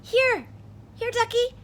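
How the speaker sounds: high, loud call to the duck. Acted. Character calls out to the duck.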